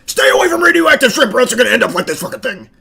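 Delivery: hammed-up scare speech for little kids; scaring toddlers. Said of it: "trying to put the fear of God in them".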